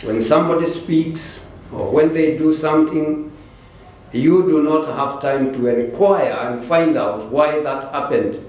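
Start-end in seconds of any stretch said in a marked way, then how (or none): none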